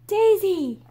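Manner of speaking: a high, loud cry of the name; shouting a name. A narrator's reading. Mei Mei spotting her hen, shouting its name high and loud. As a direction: call out the lost hen. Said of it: "Mei Mei shouted".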